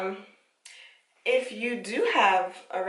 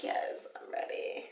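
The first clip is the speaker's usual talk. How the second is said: whispered ready; 8 dB quieter than usual. Pretend.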